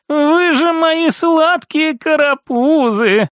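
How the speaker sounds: high, slow, doting coo; cooing to babies. A narrator's reading. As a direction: gush sweetly, stretching the words. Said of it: "приговаривала она".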